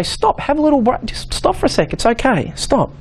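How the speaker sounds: gently calm an overwhelmed tremorer; soothing stop. Retold or imitated. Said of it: "I'll just say".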